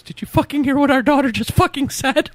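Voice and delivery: wife storming into the bathroom, hammed-up high furious yell; outraged wife. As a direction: barge in and shriek it in disbelief.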